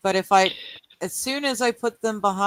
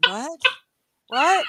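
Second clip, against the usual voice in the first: normal talking voice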